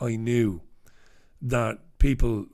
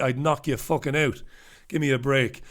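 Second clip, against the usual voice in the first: ordinary talk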